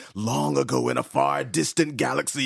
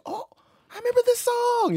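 surprised recognition; 4 dB louder than usual. Retold or imitated. Acted quote of hearing a song.

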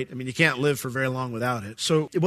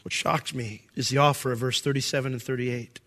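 ordinary talk, nothing marked

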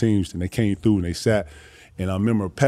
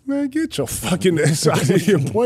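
higher dismissive voice; mock scoffing friend; imitating Wolf; 10 semitones higher, slower than usual. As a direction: brush it off as a joke. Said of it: "Wolf was like".

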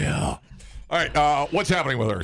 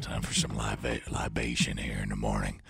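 creepy voice; 10 semitones lower, 8 dB quieter than usual. Acted. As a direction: purr it like a creep. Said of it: "That's the voice of a creep right there".

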